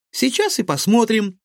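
high, loud, gleeful reply; delighted. Acted. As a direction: burst out happily, eager to go. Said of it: "обрадовался Егор".

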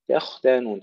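تختانون with both تاء thin and the خ heavy and still; careful recitation. Shown as a demonstration. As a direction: model تختانون for the student. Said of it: "ترقيق التاء الأولى والثانية".

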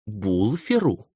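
slowly drawn-out incredulous name; slow, scornful query; disdainful disbelief. A narrator's reading. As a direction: draw the name out with distaste. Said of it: "выговорила она имя хозяина".

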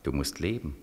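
low, hushed voice from inside; low inner voice. Acted. Inner voice urging Beckmann to wake, low and hushed. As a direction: murmur it low like a voice in his head.

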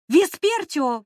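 high, loud frightened shriek; terrified squeal. Acted. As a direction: shriek the name in terror. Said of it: "в ужасе взвизгнула я".

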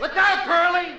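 high, loud warning shout. Acted. Man shouting the fake alarm to Curly, high and loud. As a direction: yell it like a real alarm. Staged fake accident cue.

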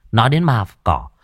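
ordinary delivery

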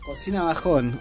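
usual speaking voice

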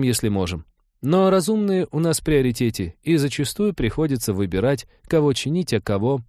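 earnest, apologetic female character; earnest Trixie. Acted. Voices Trixie explaining.

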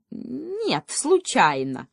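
drawn-out sarcastic denial; slow, mocking retort; ironic reply. A narrator's reading. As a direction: start high on Нет, then drop into dry irony. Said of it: "с иронией отозвался заяц".

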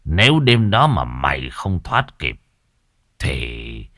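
low ominous sorcerer voice; ominous old master. Acted. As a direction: warn of the river ghost darkly. Master explains the ghost.

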